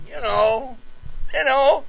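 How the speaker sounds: high-pitched, coy hinting; retold sheepish, high-voiced fumbling; awkward hinting. Awkward suitor fumbling his proposal.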